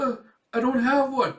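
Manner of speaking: high, loud worried answer; acted panicky admission; nervous student. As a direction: confess anxiously to a strict teacher. Student confesses to inspector.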